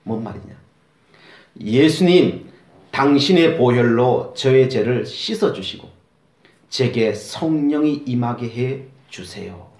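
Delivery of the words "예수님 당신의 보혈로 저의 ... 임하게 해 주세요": slow, solemn prayer, word by word; slow prayer line. Shown as a demonstration. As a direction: lead the sinner's prayer slowly and solemnly. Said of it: "한번 따라해 보실까요?".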